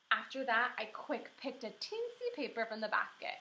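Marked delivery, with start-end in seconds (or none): none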